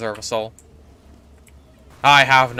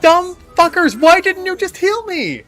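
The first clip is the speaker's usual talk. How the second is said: high, loud furious yelling; angry shout; 8 dB louder than usual. Real, not acted. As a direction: yell at the useless team. Dying, teammates ignored him.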